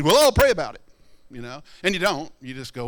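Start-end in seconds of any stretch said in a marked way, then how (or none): 0.0-0.8 high, loud, mock pious brush-off; mock pious dodge. Preacher mimicking a churchgoer's pious dodge, high and loud.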